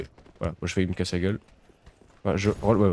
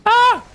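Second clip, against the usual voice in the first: sudden high, loud shriek; a jolt of fright, screamed out; startled scream; 9 dB louder than usual. Real, not acted. Sudden scare in game.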